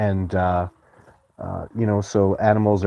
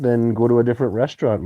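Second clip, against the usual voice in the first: usual speaking voice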